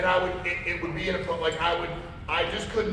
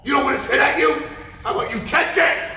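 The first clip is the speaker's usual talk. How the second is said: much louder, higher full shout; retold yell at son; 8 semitones higher, 8 dB louder than usual. Dad yelling at his son for missing the ball, full shout. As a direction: bark it across the field at him.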